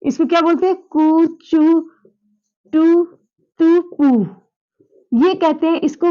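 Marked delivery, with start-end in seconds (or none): 0.8-4.3 कु, चु, टु, तु, पु each with a clear u sound and pauses; udit letter demo. Shown as a demonstration. Teacher showing how the udit letters sound, clear syllables. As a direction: spell out each syllable with u for the class. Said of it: "इनको हम ऐसे बोल सकते हैं".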